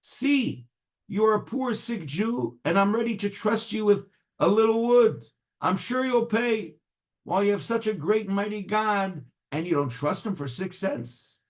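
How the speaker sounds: high, earnest chiding; exhorting Rebbe. A narrator's reading. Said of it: "says the Rebbe".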